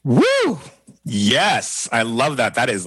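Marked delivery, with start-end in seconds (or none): 0.0-0.6 high, loud cheer of delight; excited whoop. Real, not acted. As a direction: let out a big joyful woo. Host cheers after guest's message.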